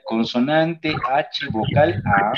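ordinary delivery

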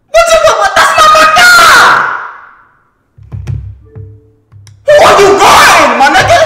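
high frantic shouting; panicking gamer. Real, not acted. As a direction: yell in panic at the screen. Panicking during game play.

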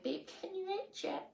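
whisper-soft ask from bed; soft pleading. Retold or imitated. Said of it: "you're like".

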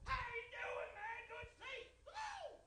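high-pitched, exaggerated greeting voice; loud church lady. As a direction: gush like the loudest lady in church. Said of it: "This is their normal voice".

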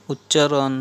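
usual speaking voice

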